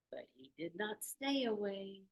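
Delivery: usual speaking voice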